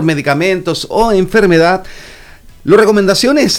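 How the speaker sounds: normal talking voice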